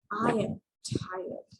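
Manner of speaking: slow, drawn-out tired delivery; really tired. Shown as a demonstration. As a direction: drag the words out, worn out after a long day. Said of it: "if I'm really tired, I had a long day".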